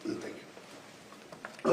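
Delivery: quiet, voice catching with real emotion; barely getting the words out, holding back tears; a hushed, emotional thank you; choked up. Real, not acted. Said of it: "a little emotional here".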